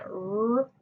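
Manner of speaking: "r" held alone as a long rrr sound; growl-free, drawn-out "r" for phonics; isolated sound. Shown as a demonstration. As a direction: sustain "r" as a sound, not the letter name. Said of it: "snip that second phoneme".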